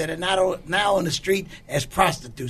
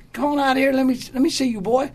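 calling out; 7 semitones higher, faster than usual. Retold or imitated. Friend calling him from room.

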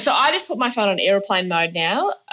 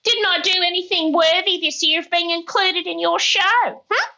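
high, hurt mock-mum whine; higher-pitched imitation of a miffed mother; sooky mum; 9 semitones higher than usual. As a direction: play the sulky mum fishing for a mention. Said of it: "she was all sooky afterwards and she goes".

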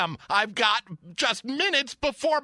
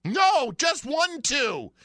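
slow over-enunciated correction; 2 semitones higher, slower than usual. Acted. Correcting the phone menu slowly.

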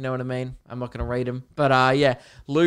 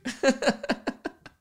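high giggle; 11 semitones higher than usual. Real, not acted. Laughing at his own fantasy.

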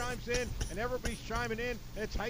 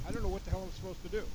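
normal talking voice

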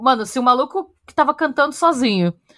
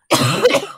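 high rasping cough; choking cough; 9 semitones higher, 4 dB louder than usual. Real, not acted. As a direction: cough up something swallowed. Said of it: "engoli um mosquito".

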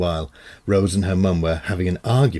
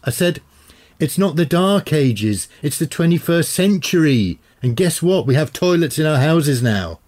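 put-on exasperated teen; 7 semitones higher, 3 dB louder than usual.

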